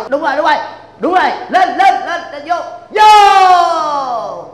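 watching a match and yelling at the players; football cheering. Acted.

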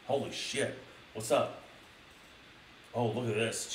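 plain reading voice

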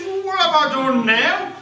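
high, loud, put-upon protest; indignant husband. Acted. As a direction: protest your innocence loudly.

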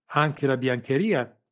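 slow, loud, coldly precise; specifying. A narrator's reading. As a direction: dwell on each word with cruel relish. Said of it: "specificò Serafico Sarrese".

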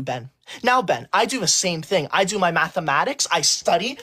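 normal talking voice

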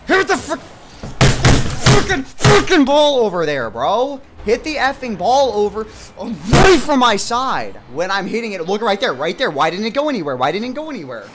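angry rant. Real, not acted. Gamer ranting loudly at his useless teammate, angry rant. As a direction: keep yelling the complaints.

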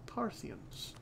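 Parthians breathed in a whisper. Pretend. Repeats the word, then whispers it.

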